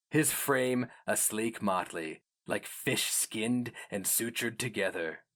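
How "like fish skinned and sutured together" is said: usual speaking voice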